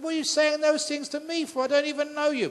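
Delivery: shocked, pleading why me; husband baffled at the rude stranger; bewildered protest. Acted. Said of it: "He said".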